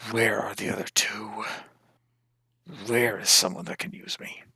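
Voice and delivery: half-whispered, eerie questioning from a totem; totem's mind voice. Acted. Totem speaking into the attuning orc's brain, eerie half-whisper. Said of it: "this thing just starts talking directly into your brain".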